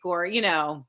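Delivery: ordinary talk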